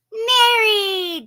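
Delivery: high, loud squealing hello; excited greeting. Real, not acted. Host greets a viewer with an excited cry. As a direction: squeal the name with delight.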